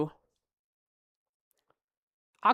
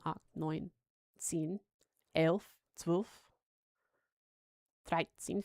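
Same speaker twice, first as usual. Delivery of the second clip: counting in German; 3 semitones lower, 4 dB quieter, slower than usual. Shown as a demonstration. Streamer testing his German, counting softly then building. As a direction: count the German numbers under your breath, building at the end.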